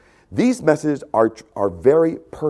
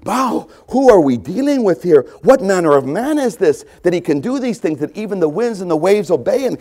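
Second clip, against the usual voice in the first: higher, louder wonder; amazed disciples; 6 semitones higher, 3 dB louder than usual. Acted. Companions marvelling at who Jesus is, amazed. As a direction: marvel aloud at the catch.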